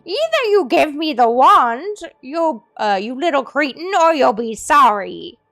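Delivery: loud, high enraged snarl; furious threat. Acted. Old man threatening the group with a gun, furious. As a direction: scream the ultimatum waving the gun.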